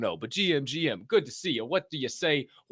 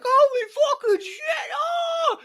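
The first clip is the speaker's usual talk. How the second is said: much higher and louder, slow crying yell; retold shock; 4 dB louder, much slower than usual. Telling Londa Ray how he reacted to her win, with a retold shout. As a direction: relive the shock of the news in a yell. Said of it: "And I was like".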